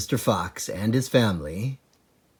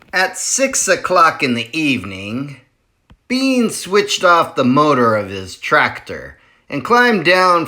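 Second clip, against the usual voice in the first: lively, louder read-aloud narration; story narration; 2 semitones higher, 5 dB louder than usual. A narrator's reading. Reading a chapter book aloud to children, lively. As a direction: tell it big for young listeners.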